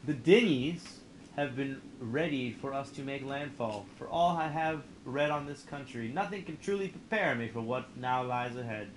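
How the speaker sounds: slow, grand story narrator voice; dramatic narration. Acted. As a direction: read it like an epic game intro. Reads game narration aloud.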